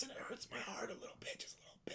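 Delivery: hushed mock-sad whisper. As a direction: confess the hurt under your breath. Mock grief over friend's death.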